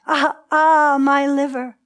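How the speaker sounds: storyteller's stylized answering wail; echoed cry. A narrator's reading. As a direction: cry along with the sufferer. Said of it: "They cried".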